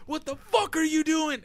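hammed-up startled shout; mock alarmed yell. Retelling a buddy yelling at him in the dark, mock yell. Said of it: "So I had my video out".

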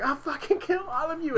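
high, strained imitated angry crying threat; mock angry threat. Crying recruit threatening the whole platoon, high angry strain. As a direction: threaten them in a high, choked voice through angry tears. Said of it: "He's like".

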